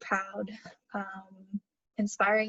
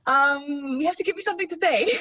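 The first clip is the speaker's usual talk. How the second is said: higher British accent put on for show; 4 semitones higher, 4 dB louder, faster than usual. Shown as a demonstration. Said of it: "Can you do an accent?".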